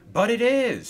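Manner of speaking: high, loud, bright-smiled answer; smiling reply. Acted. Coldy answers that he is alone, smiling. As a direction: say it through a big smile. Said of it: "smiled Coldy".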